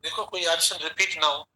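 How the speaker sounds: dictating each option word by word, slowly; teacher dictating answer options in a measured pace; slow dictation. Acted. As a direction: read it out so students can write along.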